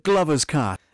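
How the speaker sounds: peaking, clipped loud read; distortion. Acted. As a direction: overdriven mic, peaking hard. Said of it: "you can hear the, uh, distortion as it peaked".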